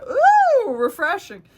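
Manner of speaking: squealed high ooh then a wry word; a falsetto yelp of relief; high loud ooh, then refreshing; mock delighted ooh. Wind blowing on exposed back.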